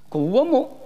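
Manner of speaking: normal talking voice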